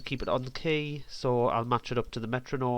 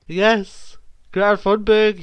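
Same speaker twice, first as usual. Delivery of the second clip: excited; 8 semitones higher, 9 dB louder, slower than usual. Real, not acted. Gleeful at his joke.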